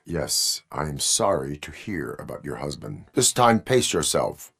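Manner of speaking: usual speaking voice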